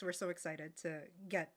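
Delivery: ordinary talk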